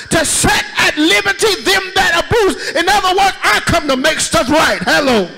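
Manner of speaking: whooping preacher crying out to the church; preaching at full force. Acted. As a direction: build the sermon to a roaring peak. Preaching climax, shouting.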